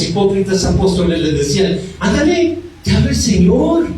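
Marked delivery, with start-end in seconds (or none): 2.0-3.9 high, sugary hurry-up; mock-sweet coaxing. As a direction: fake friendliness now the Lord listens. Hypocritical apostles now coax him.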